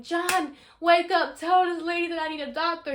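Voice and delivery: desperate to rouse him mid-labor; retold frantic plea to a husband; urgent call. Retelling trying to wake husband.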